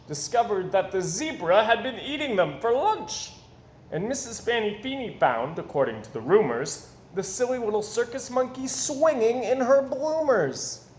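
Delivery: ordinary delivery, nothing marked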